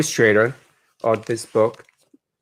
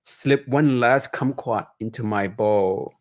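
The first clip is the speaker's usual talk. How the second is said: put-on reading aloud to children, lively and higher; picture book narration; 4 semitones higher than usual. A narrator's reading. Author reading his Chinatown memoir to children, expressive storytelling. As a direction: paint each image for young listeners.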